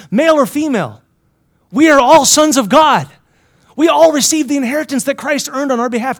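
high, loud preaching; impassioned proclamation. Real, not acted. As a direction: proclaim it to the whole room. Preacher proclaiming at full force.

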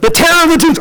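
high, urgent repeat; exasperated repeat. Acted. Shepherd repeating the dreaded name to townspeople, urgent. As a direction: say it up high for someone who missed it. Repeats louder after being asked what.

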